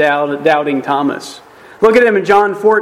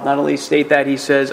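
ordinary talk

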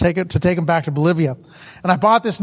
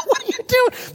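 very high, mock-appalled question; incredulous. Teenage son questioning mom ditching the TV, incredulous. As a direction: squeal it in comic disbelief. Re-enacts shock at mother.

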